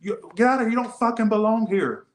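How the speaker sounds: high mock shout; mock yell, pitched high. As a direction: imitate the strangers yelling at you. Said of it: "They said".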